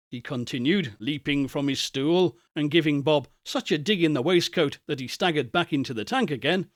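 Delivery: plain reading voice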